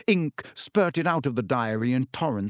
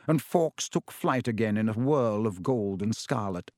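ordinary delivery, nothing marked

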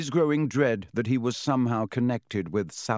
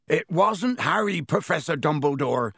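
high, pleading rush of a big man; urgent Hagrid; 5 semitones higher than usual. A narrator's reading. Hagrid bursting in to defend Harry, urgent. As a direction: blurt it out to defend the boy. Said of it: "said Hagrid urgently".

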